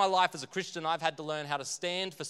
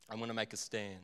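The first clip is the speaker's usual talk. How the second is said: low, soft mock resolve; hushed, low self-reassurance; quiet resolve; 7 semitones lower, 12 dB quieter, faster than usual. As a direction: say it under your breath, as if convincing yourself. Repeats the resolve to himself.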